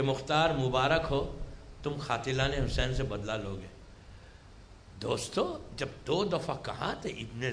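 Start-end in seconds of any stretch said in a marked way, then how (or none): 0.0-3.7 low, soft, measured retelling; same unshaken tone. Retold or imitated. Misam congratulates chained Mukhtar, in the same unshaken tone. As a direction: keep it hushed and even, low in pitch. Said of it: "Maisam نے اسی tone میں کہا".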